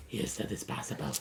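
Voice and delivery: low, whispery lizard rasp; lizard folk voice. Acted. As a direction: rasp it out like a scaly merchant. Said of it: "wait, lizard folk voice".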